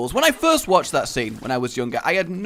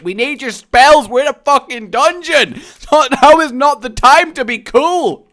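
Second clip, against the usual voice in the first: mock angry yell; 10 semitones higher, 10 dB louder than usual. Yelling at fictional wizard player.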